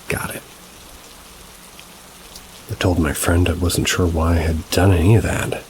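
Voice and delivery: plain reading voice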